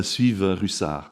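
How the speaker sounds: suivent Russard read slowly in French; careful French reading; French pronunciation. Shown as a demonstration. Professor reads French example sentence. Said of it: "is that how you pronounce it".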